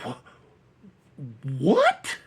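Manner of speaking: can't believe what he just saw; stunned what. Retold or imitated. Teller reacting to the slap in disbelief, stunned. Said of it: "I'm like".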